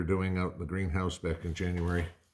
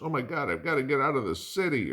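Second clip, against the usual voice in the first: higher, louder, faster panicky cry; mock panic; 9 semitones higher, 3 dB louder, faster than usual. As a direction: play the frantic city dweller fleeing. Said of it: "if everybody goes".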